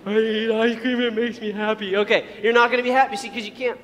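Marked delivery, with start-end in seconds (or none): 0.1-2.0 goofy put-on voice; hammed-up dopey contentment; imitating bedridden overeater. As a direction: play an ice-cream glutton insisting on contentment. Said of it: "and you're like".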